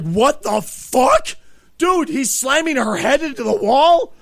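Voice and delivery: loud, incredulous outburst; shocked disbelief. Retold or imitated. Reacting to the banging next door, loudly incredulous.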